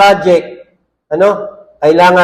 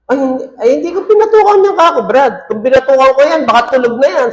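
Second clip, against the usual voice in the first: much higher, rushed protest; flustered denial; 6 semitones higher, faster than usual. Retold or imitated. Pastor retelling his rushed defence to the angry father, flustered. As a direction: talk fast to talk your way out.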